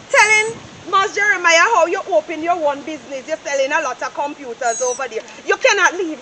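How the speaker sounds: ordinary talk, nothing marked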